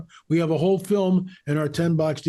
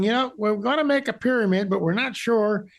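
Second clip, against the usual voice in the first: put-on Southern accent; 6 semitones higher than usual. Guest mocks would-be pyramid builders, Southern accent. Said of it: "Why the Southern accent, L A".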